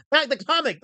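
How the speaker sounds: "Not the comic!" loud and high with "Not" stressed; emphatic correction. Shown as a demonstration. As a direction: hit "Not" hard in "Not the comic!". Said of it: "From Jeff Smith!".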